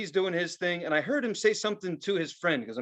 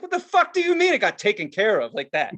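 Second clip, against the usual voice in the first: louder, indignant mock outburst; retold loud protest; 5 semitones higher, 6 dB louder than usual. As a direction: blow up at the waiter. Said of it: "I got as loud as my cousin did".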